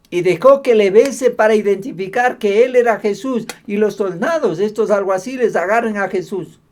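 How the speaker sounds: loud, high-pitched sermon delivery; forceful preaching. Acted. Preacher retelling the arrest of Jesus, forceful preaching. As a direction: drive the story home to the congregation.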